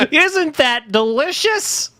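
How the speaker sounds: high, slow puppet-like voice; Kramit the Frog. Pretend. Mock puppet mascot selling junk, high slow voice. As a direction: sweetly shove it down their throat. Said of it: "my name is Kramit the Frog".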